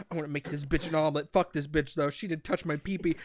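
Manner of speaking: low, soft, quick grumpy male voice; grumbling sulk. Retold or imitated. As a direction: grumble it under the breath, petulant. Voicing the sulking cat at breakfast.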